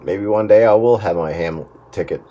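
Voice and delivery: normal talking voice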